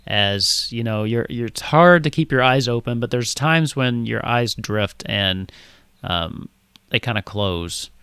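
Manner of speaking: ordinary delivery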